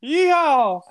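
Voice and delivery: high loud cowboy whoop; yeehaw. Pretend. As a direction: holler it like a rodeo cowboy. Cowboy call after Howdy cowboy.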